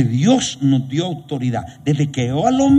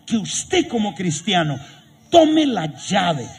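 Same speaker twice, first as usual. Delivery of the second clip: raised, driving sermon voice; fiery preaching; 5 semitones higher than usual. Acted. Preacher rousing the congregation, fiery preaching. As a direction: preach it out, building.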